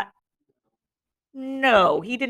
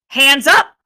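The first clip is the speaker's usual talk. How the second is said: loud, higher-pitched order; strong command; 6 semitones higher, 8 dB louder than usual. A narrator's reading. Jack to the silent boys, commanding strongly. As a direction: call for the vote forcefully. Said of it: "said Jack strongly".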